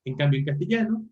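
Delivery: usual speaking voice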